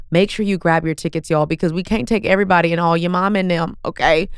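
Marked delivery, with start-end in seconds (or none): none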